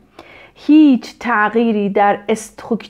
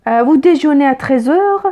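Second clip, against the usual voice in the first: "heures?" lifted at the end; rising question tune; 2 semitones higher than usual. Shown as a demonstration. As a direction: question by intonation only, ending on "heures?". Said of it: "تغییر لحن یعنی".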